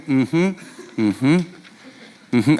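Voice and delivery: humming through closed lips, slowly; mouth taped shut. Shown as a demonstration. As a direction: try to talk with your mouth taped. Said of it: "I put a piece of duct tape over my mouth".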